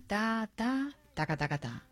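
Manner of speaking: sílabas rítmicas, en voz baja; ritmo de bachata. Shown as a demonstration. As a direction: marca el compás de bachata suavemente. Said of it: "para mí la bachata tiene". (tarareo percusivo y suave)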